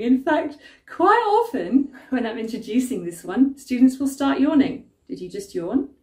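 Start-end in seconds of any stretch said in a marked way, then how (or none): none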